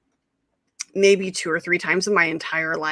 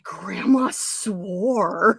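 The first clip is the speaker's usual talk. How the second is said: drawn-out, astonished revelation; scandalized, slow-motion disbelief; mock shock; 2 semitones lower, 4 dB quieter, much slower than usual. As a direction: stretch it slowly in retold disbelief. Retold shocked reaction to swearing.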